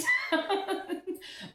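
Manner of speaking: bright high laugh. Real, not acted. Laughing at the commenter while addressing viewers. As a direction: laugh helplessly.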